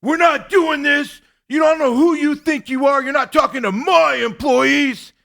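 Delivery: full-volume high imitation of an outburst; yelling. Host imitating Joe losing his mind on the phone, yelling. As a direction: yell it slowly, losing your mind. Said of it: "He starts yelling at me on the phone".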